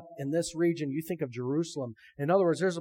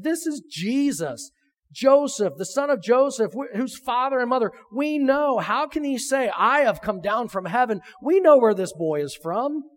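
loud, high-pitched indignant protest; indignant grumbling; 7 semitones higher, 7 dB louder than usual. Acted. Crowd objecting that they know his family, loud indignant grumbling. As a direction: complain with raised voice, in disbelief.

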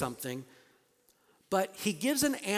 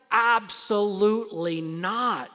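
slow, loud, higher absolutely not; emphatic refusal; 4 semitones higher, 7 dB louder, slower than usual. Retold or imitated. Preacher giving Paul's answer, loud and slow. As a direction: voice Paul flatly refusing. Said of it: "he's saying".

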